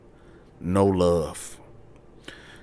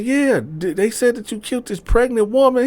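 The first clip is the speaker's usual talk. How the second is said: much higher, matter-of-fact; girlfriend explaining; 14 semitones higher, 4 dB louder than usual. Retold or imitated. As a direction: tell him what the news said. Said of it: "She said".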